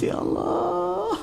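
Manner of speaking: a hammed-up weeping cry to God; a sermon example of crying, wailed; wailing cry. Acting out how one should cry.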